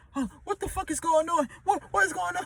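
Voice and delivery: high, hushed panic; mock freak-out as it all goes wrong; panicked confusion. Sinking passenger asking what is happening, hushed high panic.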